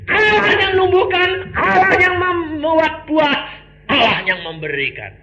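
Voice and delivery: high, loud, slow proclamation; preaching. Acted. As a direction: proclaim it slowly and forcefully. Preaching climax. (khotbah lantang dan lambat)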